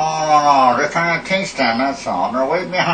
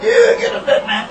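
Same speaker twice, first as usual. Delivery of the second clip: laughing squeal; 4 dB louder than usual. Real, not acted. Laughing squeal mid-argument.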